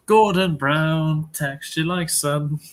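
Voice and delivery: slow, deadpan quoting of a silly comment; slow mock-solemn read. Reading a viewer's comment slowly to the hosts, deadpan. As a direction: read it out slowly, straight-faced. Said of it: "Rory's comment".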